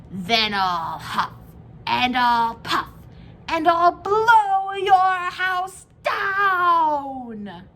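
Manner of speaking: slow, loud wolf roar building high; big bad wolf. A narrator's reading. Wolf threatening the three pigs, slow and roaring. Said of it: "So the wolf showed his teeth".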